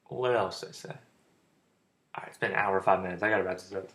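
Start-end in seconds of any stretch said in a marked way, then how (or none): 0.6-2.4 breathy whispered aside. Real, not acted. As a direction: whisper it to yourself. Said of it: "whispering aside".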